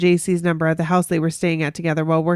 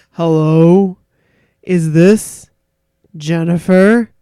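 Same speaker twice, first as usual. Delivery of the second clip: louder, mock deep phone voice; deep boy's voice; 2 semitones lower, 9 dB louder, much slower than usual. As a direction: greet her like a grown-up guy on the phone. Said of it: "This deep voice says".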